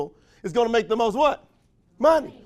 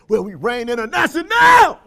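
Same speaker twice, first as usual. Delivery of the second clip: loud burst of pride; mock proud shout; proud cheer; 3 semitones higher, 8 dB louder than usual. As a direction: announce it proudly.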